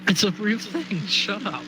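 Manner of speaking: high, loud, giggling denial; laughing protest. Real, not acted. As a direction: insist through laughter. Laughing protest about pen license.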